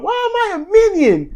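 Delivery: very high mock-childish protest; cute foot-stomping whine. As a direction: imitate an adorable little tantrum. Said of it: "Billie is super cute. She stomps her foot".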